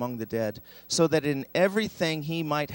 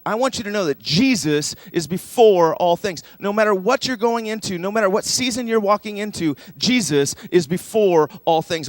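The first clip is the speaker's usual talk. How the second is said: higher, louder, rising preacher's build to a declaration; full-force preaching; 5 semitones higher, 7 dB louder than usual. Acted. Preacher to congregation facing hard seasons, loud and soaring. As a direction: preach it out big to lift the room.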